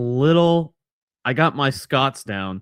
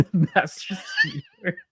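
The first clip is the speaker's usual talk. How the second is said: laughing; slower than usual. Real, not acted. Laughing echo of partner's joke.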